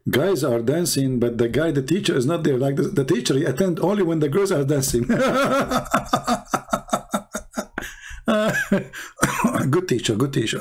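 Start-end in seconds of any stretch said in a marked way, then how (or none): 5.1-9.7 high cackling laugh fit; long high laugh. Real, not acted. Laughing at the teacher who only shows up for the girls.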